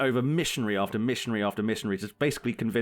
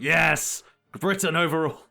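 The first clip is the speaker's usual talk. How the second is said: louder, high-pitched patriotic cheer; mock imperialist scream; 5 semitones higher, 4 dB louder, slower than usual. Joking about his inner British imperialist, mock triumphant scream. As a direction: cry it out like a hammed-up empire fanatic. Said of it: "part of my D N A just screaming".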